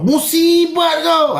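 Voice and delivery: high, slow, cutting "Musibat kau."; mock harsh clip for the editor; sharp insult. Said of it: "macam contoh".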